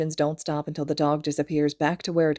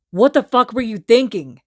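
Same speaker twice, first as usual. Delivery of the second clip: loud, higher furious roar; 6 semitones higher, 10 dB louder than usual. A narrator's reading. Kacchan roars at Izuku for freezing, furious. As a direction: blast him with rage. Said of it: "he roars".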